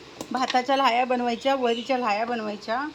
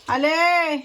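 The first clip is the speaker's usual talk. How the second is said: raised, louder holler; calling out; 4 semitones higher, 7 dB louder, much slower than usual. Real, not acted. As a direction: call back that you're coming. Calls out to someone waiting.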